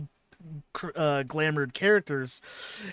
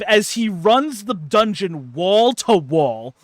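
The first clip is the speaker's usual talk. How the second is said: ordinary delivery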